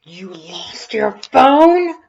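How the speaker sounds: little brother scandalized at Red; jarring shout. Acted. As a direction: bellow it in disbelief. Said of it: "a much more jarring voice".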